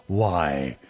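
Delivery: ordinary delivery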